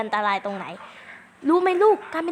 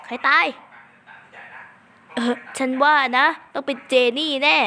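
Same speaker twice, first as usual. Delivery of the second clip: ordinary talk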